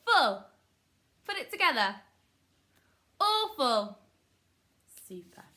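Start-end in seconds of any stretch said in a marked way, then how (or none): 0.1-4.0 loud and high, falling on each phrase; teacher's call-out. Shown as a demonstration. Teacher leading pupils through syllables, loud high call. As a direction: call it out high for a class to repeat.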